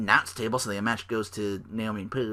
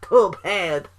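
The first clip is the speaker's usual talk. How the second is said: loud, higher mock Russian accent; bad Russian accent; 7 semitones higher, 6 dB louder, slower than usual. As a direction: exclaim it as a cartoon Russian. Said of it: "really bad stereotypical Russian accent".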